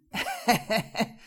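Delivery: loud goat-like cackle; bleating laugh. Acted. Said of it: "ein meckerndes Lachen". (meckerndes Ziegenlachen)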